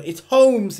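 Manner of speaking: loud, high shout on the name; excited chance call. Real, not acted. Commentator calling his team's last chance, excited. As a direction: leap up in hope.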